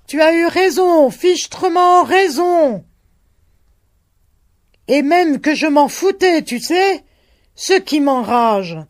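high, loud angry shouting. A narrator's reading. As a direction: shout with rising rage. Shouted dialogue continues.

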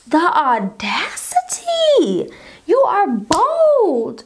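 slow breathy outrage, high and drawn out; appalled. Retold or imitated. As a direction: savour each word in disbelief. Finds her stolen stuff.

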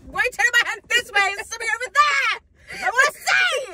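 loud, high, eager shouting to see; hammed-up loud eager demand; excited shouting. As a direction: yell like a kid desperate to look. Acting out gawking at something.

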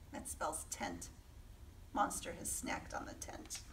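hushed reveal; whispered aside. A narrator's reading. Reading to children, whispering what Monster ate. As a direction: tell them the secret softly.

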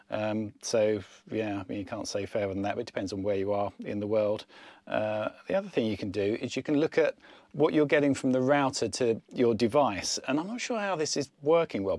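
usual speaking voice

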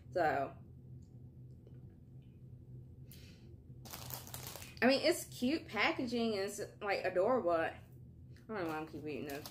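chewing while talking; biting crisp wafer; mouth full. Real, not acted. Seen 2: Holds green wafer, bites it at 2.1–2.8s while talking.

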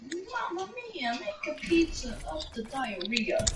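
put-on slow, hammy Italian accent; jolly Italian pizza chef. After picking character, silly voice.

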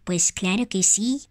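mother toy voice. Pretend. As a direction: play the gentle mother of Tag. Plays Tag's mother.

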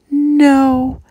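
high, snuffly little no; snuffling. A narrator's reading. Wet hamster snuffles a sad no to her sister. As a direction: sniff through the word. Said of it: "Harriet snuffled".

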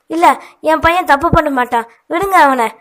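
high, loud desperate cry; mother shouting as her son is arrested. A narrator's reading. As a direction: shout at the police to let him go. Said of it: "என வாசுகி கத்தினார்".

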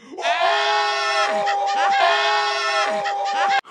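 high, loud burst of laughter; loud cackling laugh. Acted. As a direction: howl with laughter. Big laugh at own joke.

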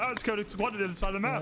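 mock quote. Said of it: "he's like".

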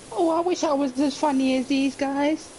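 high, whiny jealous viewer; mock the jealous fans.

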